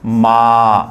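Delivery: मा held long and loud on a level tone; long vowel. Shown as a demonstration. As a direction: stretch the vowel of मा right out. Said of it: "काफ़ी long जारी sound". (मा को ज़ोर से लंबा खींचकर)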